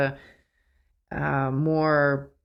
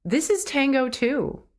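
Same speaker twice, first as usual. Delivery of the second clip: higher, lit-up inner voice; sudden realization; 9 semitones higher than usual. Retold or imitated. Retelling a mid-dance epiphany to listeners, higher excited inner voice. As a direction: voice the sudden insight with a lift. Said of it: "because suddenly I was like".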